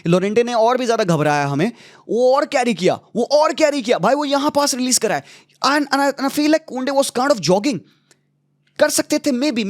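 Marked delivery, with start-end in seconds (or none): 2.1-9.6 high-pitched, worked-up exasperation; exasperated. Real, not acted. Fan reliving the defender's mistake for viewers, exasperated. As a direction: relive the blunder in a raised, pleading pitch. Exasperated replay of the conceded goal.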